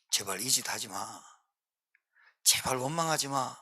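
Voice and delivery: low, hushed plea; 엎드려 애원. Acted. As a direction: beg softly, as if clutching their ankles. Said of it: "제가 여러분 발목 잡고 엎드렸어요". (발목 잡고 나직이 애원하듯)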